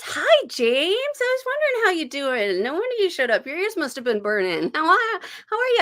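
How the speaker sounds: gushing greeting; excited greeting. Real, not acted. Greeting live viewers.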